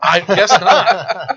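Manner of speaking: slow words broken by laughter; laughing. Real, not acted. Amused reply about the clueless friend: slow laughing.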